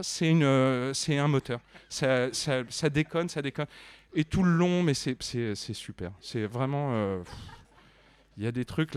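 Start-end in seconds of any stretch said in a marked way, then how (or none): none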